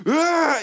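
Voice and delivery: long sigh into a high "Ah"; dreamy sigh then high pleased ah; blissful inhale. Pretend. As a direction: breathe it in like a heavenly smell. Mock inhaling the gas aroma.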